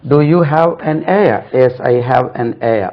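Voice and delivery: heir said like "air" after "an"; slow question and answer, no h in heir; silent h. Shown as a demonstration. Teacher drills the word in sentences, slow silent-h pronunciation. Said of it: "ஏ தான் சொல்ல வேண்டும்".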